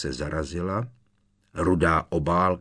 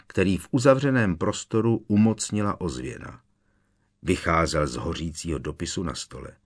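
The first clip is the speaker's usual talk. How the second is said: ordinary delivery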